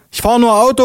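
very high put-on policewoman voice; high, curt-tempered policewoman; calling policewoman. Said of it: "ruft Sabine".

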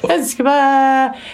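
thrilled. Real, not acted. Thrilled about visit.

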